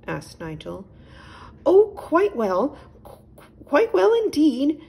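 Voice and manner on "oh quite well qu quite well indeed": high, louder, flustered owl voice; a prim owl politely insisting all is fine; high, fluttery owl; fussy little owl. A narrator's reading.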